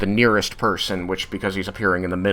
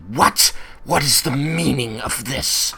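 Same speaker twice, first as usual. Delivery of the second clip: raised, demanding outrage; higher-pitched affronted demand; Tiamat; 5 semitones higher than usual. Acted. Tiamat demands the meaning of this, indignant. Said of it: "hear that voice come back again".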